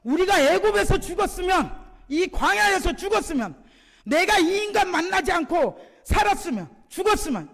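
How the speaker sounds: high-pitched, loud mock wailing; hammed-up lament, each phrase pitched high; resentful complaining. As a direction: imitate bitter grumbling in bursts. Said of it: "원망하면서".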